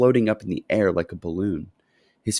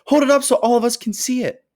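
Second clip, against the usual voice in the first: high, louder crowd call; shout; 5 dB louder than usual. A narrator's reading. Someone in the crowd calling for the ticket to be held up, high. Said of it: "somebody shouted".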